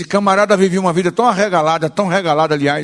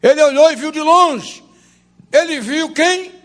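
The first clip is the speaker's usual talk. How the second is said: raised, ringing pulpit voice with stretched key words; impassioned preaching; 8 semitones higher, 4 dB louder, slower than usual. Real, not acted. Preacher insisting to the church that the story is real, impassioned. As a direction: preach it higher and forceful.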